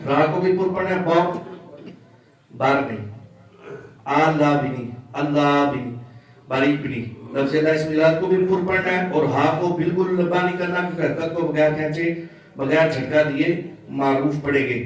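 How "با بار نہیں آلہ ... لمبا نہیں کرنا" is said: ordinary delivery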